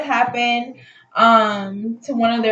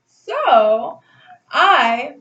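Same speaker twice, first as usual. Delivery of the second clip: ordinary delivery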